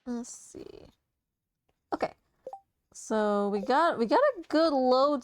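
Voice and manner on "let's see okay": under-the-breath whisper. Real, not acted. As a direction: whisper it to yourself. Muttered to self.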